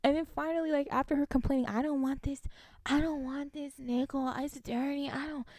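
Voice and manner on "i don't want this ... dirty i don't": higher, slow, fading mock whine; mock whiny customer. Mocking the customer over the dirty nickel, whiny. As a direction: mimic her petty whining.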